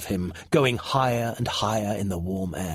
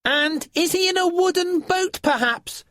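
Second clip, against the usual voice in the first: calling creature. A narrator's reading. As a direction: dangle the hint down to the boy.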